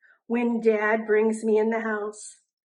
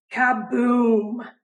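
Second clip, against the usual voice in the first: breathy kaboom; 5 dB louder than usual. A narrator's reading. Explosion sound word.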